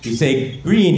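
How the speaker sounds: normal talking voice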